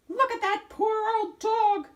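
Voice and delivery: high, loud little squirrel voice; a squeaky young squirrel mocking the wolf; high squirrel chatter, loud. A narrator's reading. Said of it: "one of them said".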